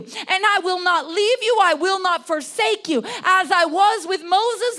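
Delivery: loud, high prophetic proclamation; impassioned preaching. Acted. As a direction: declare God's promise at full force. Voices God's promise, preaching at full force.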